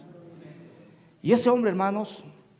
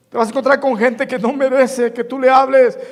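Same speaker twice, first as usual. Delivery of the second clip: loud, higher sermon exhortation; fervent preaching; 4 semitones higher, 8 dB louder than usual. Acted. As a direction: press the point on the flock.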